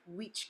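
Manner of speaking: "which" as weech; Spanish I. Shown as a demonstration. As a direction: ee sound in "which". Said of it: "the letter I says E".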